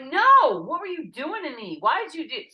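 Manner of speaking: high, loud retold outburst; acted-out exasperated shouting; indignant protest. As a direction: burst out with a big NO, then demand answers. Retold quarrel with the team.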